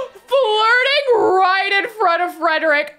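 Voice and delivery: a high, loud gasp into a cry; shocked gasp. Real, not acted. Gamer gasps as her sim flirts openly.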